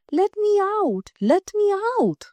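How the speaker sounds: a caged bird pleading with the princess; pleading little bird. A narrator's reading. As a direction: beg to be let out in a high voice. Caged bird begs to be freed.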